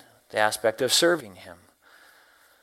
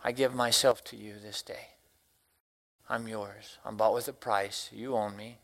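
prayer; 3 semitones lower than usual. Acted. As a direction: give yourself to God in prayer. Speaker voices a morning prayer.

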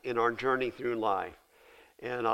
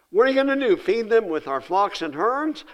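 much higher and louder, protesting in disbelief; incredulous; 7 semitones higher, 7 dB louder than usual. Acted. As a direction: challenge God as if the plan is absurd. Moses arguing with God incredulously.

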